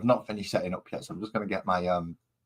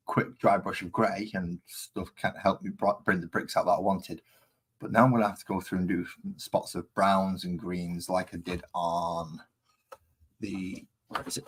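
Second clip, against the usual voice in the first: ordinary talk, nothing marked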